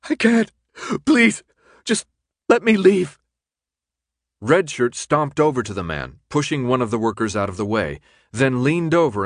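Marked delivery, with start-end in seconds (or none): 0.1-3.2 high, loud, broken-up plea; desperate begging. A narrator's reading. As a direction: beg in panic, word by word. Sick man begs to be freed.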